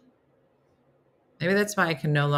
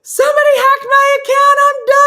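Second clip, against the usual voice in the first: panicked outcry; 12 dB louder than usual. Retold or imitated. Panicking over a hacked account.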